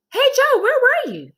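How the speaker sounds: put-on high, loud kid call; child John. Classmate calls to Joe, high and loud.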